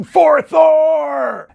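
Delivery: high, loud, drawn-out ritual cry; worshippers' scream. Acted. Worshippers throwing offerings into the forge, screaming. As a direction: scream it as a devoted battle cry. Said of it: "and they would scream".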